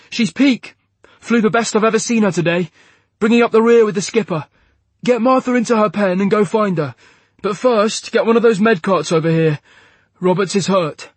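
loud, high, urgent commands; shouting orders. Acted. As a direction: call it out over the noise of the flight deck. Pilot barking orders after landing.

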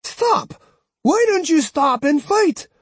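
very high, loud demand; demanding shout. Acted. Old woman shouting at the departing swordsman, high and loud. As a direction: shout after him to stop.

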